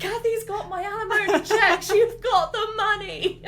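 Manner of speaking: high, hammed-up ex-husband rant; comic exasperated ex-husband; ranting dad. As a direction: wail in mock outrage through laughter.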